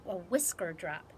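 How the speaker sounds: ordinary delivery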